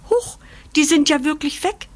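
puzzled little puppy taken aback; at a loss. A narrator's reading. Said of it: "murmelt er ratlos".

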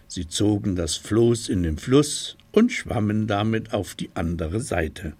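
usual speaking voice

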